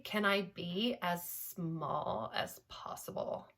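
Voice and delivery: small, breathy whisper of a Kardashian sound. Shown as a demonstration. Vocal coach demonstrating the Kardashian sound to host, whispered and small. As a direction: shrink the voice to almost nothing, whispered and soft. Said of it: "this Kardashian sound going on".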